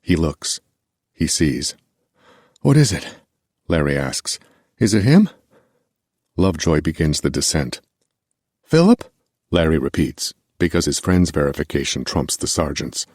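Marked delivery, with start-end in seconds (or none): 2.6-3.2 urgent question. Acted. Soldiers spotting a distant body. 4.8-5.3 higher and louder, pressing for an answer; anxious urgent question. Acted. Larry pressing Philip at the binoculars, urgent and louder. Soldiers spotting a distant body. 8.6-9.1 sharply higher, louder call down the dune. Acted. Larry calling to Philip on the dune, loud and rising. Larry wants his friend's verification.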